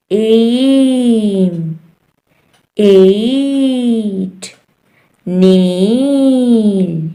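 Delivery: "ay" held very long in "एम" and "एट"; long A examples. Shown as a demonstration. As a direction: stretch the vowel of "नील" as a slow "ay". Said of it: "ए का long sound है".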